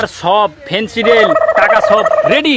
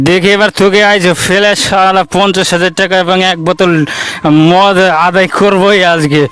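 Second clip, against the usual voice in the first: straining effort; 3 semitones lower than usual. Acted. Drunk straining, throwing body.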